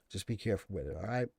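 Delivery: quiet, confiding second warning; hushed aside to viewers; soft repeat. Real, not acted.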